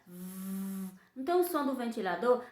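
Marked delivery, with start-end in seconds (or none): 0.1-0.9 "V" held as a soft buzz like a fan. Shown as a demonstration. Teacher showing the V sound like a fan. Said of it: "Pra falar o V, a gente faz assim".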